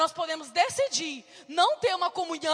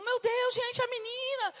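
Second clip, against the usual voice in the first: alarmed cry; 6 semitones higher than usual. Retold or imitated. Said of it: "e eu".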